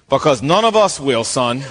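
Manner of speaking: low-pitched, dropping deadpan punchline; wry comic aside. Acted. Saul sending David off alone, wry low aside. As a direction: let the voice sink on the last word.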